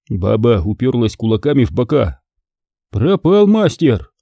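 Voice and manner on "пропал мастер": plain reading voice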